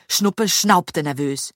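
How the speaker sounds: plain reading voice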